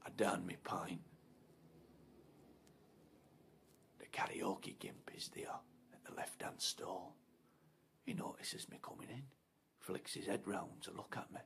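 hushed, suspenseful narration; tense whisper. A narrator's reading. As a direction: whisper it as if creeping up. Tense toilet scene whispered.

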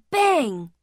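ordinary delivery, nothing marked

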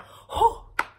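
sharp high loud gasp; mock gasp. Reviewer acting out the jump, loud mock gasp.

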